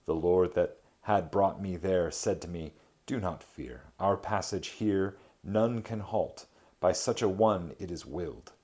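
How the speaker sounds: measured reading of an epic poem. A narrator's reading. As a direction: read the verse with gravity. Reads Dante translation aloud.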